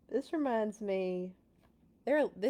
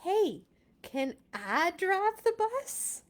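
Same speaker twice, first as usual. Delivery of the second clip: high, eager begging voice; pleading pigeon; 11 semitones higher, 3 dB louder than usual. Acted. Pigeon begging the reader to drive, high and eager. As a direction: beg sweetly like a kid.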